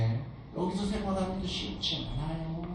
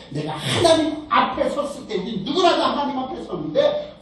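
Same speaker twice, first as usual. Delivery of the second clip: higher and louder, full-force sermon climax; fervent preaching; 6 semitones higher, 4 dB louder than usual. Acted. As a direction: preach it out to the congregation. (열정적으로 외치는 설교)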